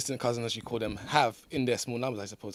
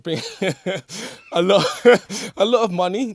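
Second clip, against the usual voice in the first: laughing through the words, louder; laughing while talking; 6 semitones higher, 9 dB louder than usual. Real, not acted. Laughs mid-sentence while making point.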